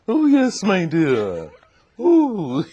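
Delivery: put-on old Southern lady, high, loud and plummy; grand, swooping ladylike impression; Dorothy Height. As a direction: coo it grandly with plums in the voice.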